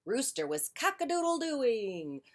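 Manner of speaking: ordinary delivery, nothing marked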